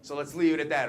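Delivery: ordinary talk, nothing marked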